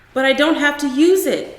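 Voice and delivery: put-on child insisting, raising his voice; loud protest.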